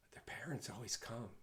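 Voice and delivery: hushed conspiratorial whisper; sharing the rumor in a stage whisper. Retold or imitated. Spreading a rumor.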